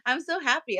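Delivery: high, mock-giddy acceptance; naive delight. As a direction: play yourself as too eager to be liked. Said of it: "The ones that I go".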